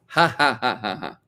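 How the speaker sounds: imitating a sneering laugh; mocking laugh. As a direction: fake mocking ha ha. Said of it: "atheists like to mock religion".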